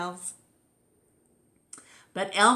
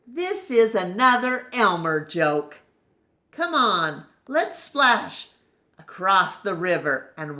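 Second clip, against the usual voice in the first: louder, rallying call; 5 dB louder than usual. Acted. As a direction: call the herd to the river. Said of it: "called out".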